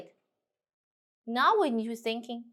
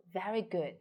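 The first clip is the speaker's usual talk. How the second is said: ordinary delivery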